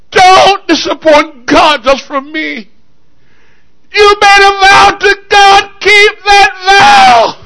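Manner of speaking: high, loud, impassioned cry; pleading daughter. Acted. Daughter begging her father to keep his vow, impassioned. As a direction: implore at full voice, stretching the key words.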